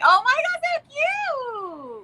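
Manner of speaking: high, squealing coo; gushing over a pet. Real, not acted. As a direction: squeal at a cute puppy. Cooing at the dog.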